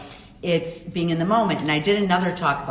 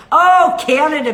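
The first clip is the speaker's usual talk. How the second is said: excited; 9 semitones higher, 10 dB louder than usual. Real, not acted. Exclaims at viewer's location.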